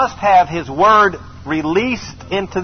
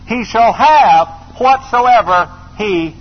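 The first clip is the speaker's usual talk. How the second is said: higher and louder, scripture thundered; preaching at full force; 4 semitones higher, 3 dB louder, much slower than usual. Acted. Preacher commanding the mountain before the congregation.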